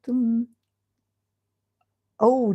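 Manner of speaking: ordinary delivery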